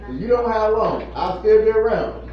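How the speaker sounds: usual speaking voice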